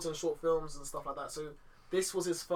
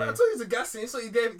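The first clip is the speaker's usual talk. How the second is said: ordinary talk, nothing marked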